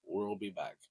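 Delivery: low, soft movie-star impression; Arnold Schwarzenegger. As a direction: lightly echo an action hero's low catchphrase. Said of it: "Is that my best Arnold impression?".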